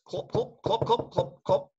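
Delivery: high tongue-clop hooves; clopping hooves. Shown as a demonstration. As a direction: clop like a trotting horse. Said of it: "Then the horse".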